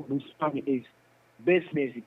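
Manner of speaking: normal talking voice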